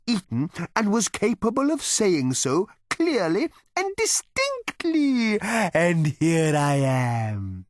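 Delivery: a cow patiently explaining itself; cow character. A narrator's reading. Cow explaining its breeding to Arthur, in a high, slowing patient voice.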